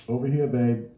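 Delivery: New Orleans counter hail; New Orleans accent. A narrator's reading. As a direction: call across the shop in New Orleans accent. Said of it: "called in that accent that occurs".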